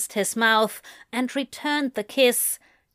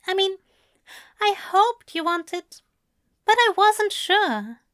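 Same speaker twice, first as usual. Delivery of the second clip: higher, halting, nervous voice; flustered Aziraphale; 8 semitones higher than usual. A narrator's reading. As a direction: stammer it out shyly.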